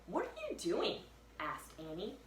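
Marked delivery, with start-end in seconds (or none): 0.1-1.0 put-on high girl voice asking; high curious girl. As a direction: ask in a high girlish voice. Annie curious.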